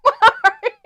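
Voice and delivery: high, loud cartoon Italian voice, laughing; giggly imitation of a game catchphrase; Mario. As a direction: stretch it out in squeaky mock Italian. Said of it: "Haven't you ever heard Mario?".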